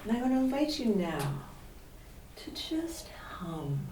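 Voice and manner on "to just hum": whispering. Acted. Gentle invitation to hum.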